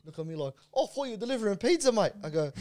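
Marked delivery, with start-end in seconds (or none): 0.7-2.1 higher-pitched mock surprise; retold cheeky surprise, pitched up; surprised banter. Tradie ribbing a delivery driver, mock surprised.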